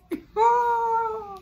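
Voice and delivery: a high, pained laugh of disbelief; high teary laugh. Real, not acted. Telling friends about the lost laptop, teary laugh. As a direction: laugh that cracks into a cry. Laughing at her own misery.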